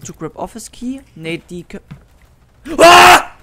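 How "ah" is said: sudden sharp scream of fright; startled scream. Real, not acted. Player screams at a jumpscare, startled shriek. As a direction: jolt and shriek at the scare.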